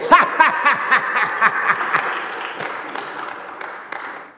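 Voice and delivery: breathy forced laugh; hammed-up laugh. Comedian angel cracking himself up, hammed up. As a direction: wheeze at your own joke. Laughing at own joke again.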